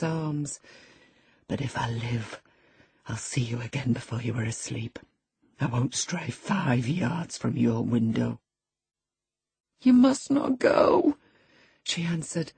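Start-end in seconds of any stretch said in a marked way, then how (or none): 1.5-8.4 low, hushed lover's vow; tender promise. Acted. Lover promises to come back. 9.8-11.2 high, drawn-out, insistent cry; cling to him with your voice; desperate plea. Acted. Dying woman clings to lover.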